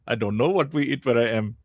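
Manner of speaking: ordinary delivery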